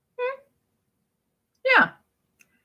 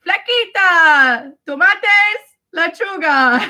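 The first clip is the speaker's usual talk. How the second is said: drawn-out kitchen call in Spanish; Antonio; 4 semitones higher, 3 dB louder, much slower than usual. Retold or imitated. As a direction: sing out the words higher, stretching them. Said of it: "Antonio would be like".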